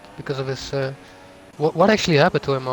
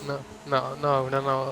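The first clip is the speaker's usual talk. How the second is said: usual speaking voice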